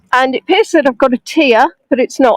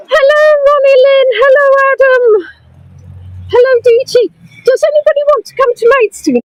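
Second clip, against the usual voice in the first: excited high greetings; 12 semitones higher than usual. Real, not acted. Live guide calling out excited hellos to new viewers. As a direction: sing out the hellos with delight.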